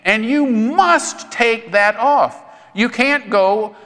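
lightly voiced wife urging him; Teresa pleading. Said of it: "You would say".

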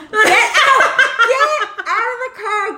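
high, loud, laughing shout; laughing yell. Retold or imitated. Mom yelling at son to get to bathroom. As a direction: yell it while cracking up.

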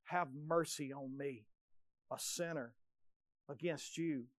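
very soft, low pleading prayer; hushed plea. Acted. Preacher voices a sinner's prayer, very soft. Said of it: "crying and pleading".

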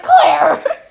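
loud, high mock shout of blame; angry sibling yell. Girl blaming sister after mom's scolding, mock angry shout. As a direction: yell the sister's name accusingly.

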